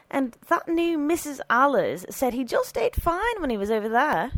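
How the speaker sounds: high-pitched protesting housekeeper; arguing. A narrator's reading. Housekeeper disputing with the priests, arguing. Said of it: "Missus Stoner argued".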